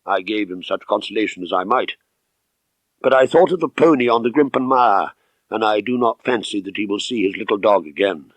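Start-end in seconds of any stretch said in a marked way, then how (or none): none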